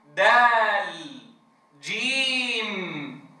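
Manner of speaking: دال and جيم stretched very slow; teacher modelling correct letter names; full letter names, held final. Shown as a demonstration. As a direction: draw out the long vowel and land the final consonant. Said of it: "وين الميم؟ وين اللام؟".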